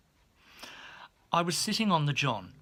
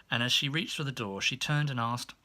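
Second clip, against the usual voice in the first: ordinary delivery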